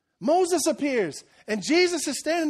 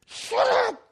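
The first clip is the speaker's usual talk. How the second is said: exasperated movie-goer; 12 semitones higher than usual. Acted. Acted movie-goer exasperated at talker.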